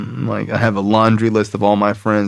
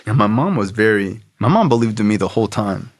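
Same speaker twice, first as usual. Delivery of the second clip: normal talking voice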